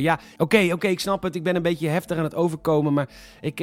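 ordinary delivery

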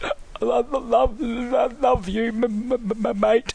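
put-on high, stammering old man; quavering drunk old man. Drunk old man voiced, retold.

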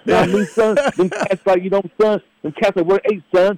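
put-on Brooklyn New York accent. Cohost shows off his Brooklyn New York accent for laughs. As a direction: play a street-tough Brooklyn New York guy. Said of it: "it'll be a Brooklyn New York accent".

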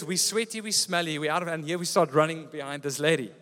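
normal talking voice

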